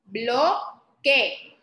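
"Bloque" split into BLO... KE; teacher's deliberate two-beat "Bloque"; syllable by syllable. Shown as a demonstration. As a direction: leave a gap in "Bloque" before KE. Teacher sounds out word by syllables.

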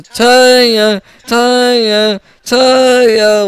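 searching parent's call. Acted. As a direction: holler for a missing child. Father calls for lost child.

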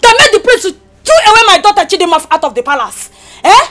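crying while screaming, high and loud; tearful furious shout. Acted. Mother mourning her banished daughter, tearful shout. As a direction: wail the accusation through tears. Mother accusing rival of witchcraft.

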